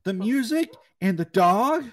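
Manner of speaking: high and loud, worked up; excited shouting. Real, not acted. Showing a friend childhood trauma, excited shouting. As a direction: shout each scare over the music.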